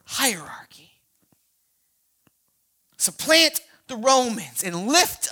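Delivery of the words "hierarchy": hushed whisper. Real, not acted. Punchline dropped after preaching.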